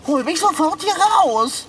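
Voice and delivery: panicked, high-pitched insistence; frantic demand. Acted. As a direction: yell to be freed at once. Trapped cat demands rescue.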